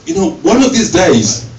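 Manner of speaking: loud warning from a mother; threatening mother. Retold or imitated. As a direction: warn with menace. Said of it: "she would actually say something like".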